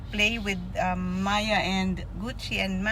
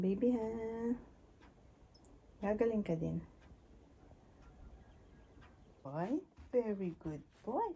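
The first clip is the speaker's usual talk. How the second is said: softer, tender lull to a pet; soothing; 11 dB quieter than usual. Real, not acted. Owner lulling her sore dog to sleep, soft and tender. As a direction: hush the dog gently to sleep.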